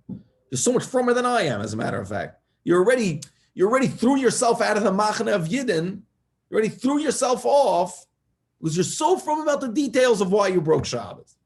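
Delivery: put-on hammering the point home to a stubborn kid; heated scolding. Rabbi lecturing Danny, loud and heated.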